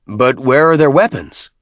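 demanding question. A narrator's reading. Tartarus at the nearest vehicle, demanding. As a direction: call it out, leaning hard on the question. Warrior inspects the new vehicle.

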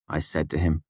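ordinary delivery, nothing marked

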